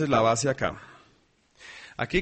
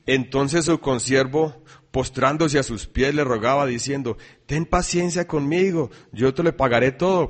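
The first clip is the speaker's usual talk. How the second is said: loud, ringing Bible reading to the church; scripture reading; 6 dB louder than usual. Acted. Preacher reading Mateo aloud, loud and ringing. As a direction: proclaim the verses to the congregation.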